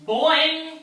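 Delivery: loud, high cartoon boing; spring up with a loud boing; bouncy leap noise for the kids; boing sound effect. Frog leaps out of water.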